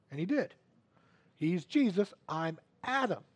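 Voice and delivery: ordinary talk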